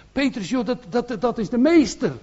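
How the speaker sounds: urgently telling Peter it is the master; eager disciple calling to Petrus; excited disciple. Acted. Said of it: "zei tot Petrus".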